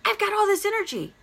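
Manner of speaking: high, quick triumphant "Yes"; a burst of thrilled energy, pitched high; gleeful self-cheer. Retold or imitated. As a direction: cheer yourself on, lifted well up. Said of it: "and I'm like".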